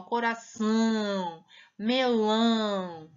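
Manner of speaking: slow coração, melão with strong nasal ão; ão bem nasal, devagar; nasal vowel demo. Shown as a demonstration. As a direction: push ão through the nose, slowly. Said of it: "Olha só como que eu estou falando".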